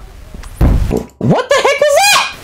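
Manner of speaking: very high, drawn-out startled cry; freaked-out squeal at the noise; shocked shriek. Real, not acted. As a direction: stretch it out in disbelief. Sudden scary noise.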